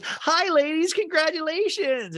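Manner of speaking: very high, stretched-out cheer; hammed-up shriek of congratulations; squealing greeting. Angie K walks up to the ladies, mock squealing. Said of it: "And N G K goes".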